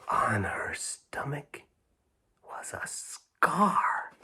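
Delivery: breathy whispered reveal, slowing down. A narrator's reading. Showing children the surprise, whispered slowly. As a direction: let the reveal hang in a whisper.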